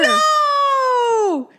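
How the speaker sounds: very high, long disbelieving cry; shocked shriek. Real, not acted. Friend hearing the matching dream, shocked shriek. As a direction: shriek it and hold it out.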